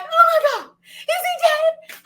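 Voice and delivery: high, loud, laughing "is he dead"; mock panic. Asking the neighbor about the spider, high laughing panic. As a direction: squeal it in half-laughing terror. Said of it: "And I'm like".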